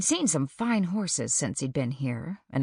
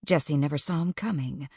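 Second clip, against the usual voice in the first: ordinary delivery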